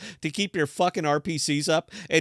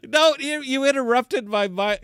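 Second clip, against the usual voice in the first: high, louder mock protest; mock indignant; 9 semitones higher, 3 dB louder than usual. Host jokingly protests to cohost, high and indignant. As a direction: squeak out a playful protest.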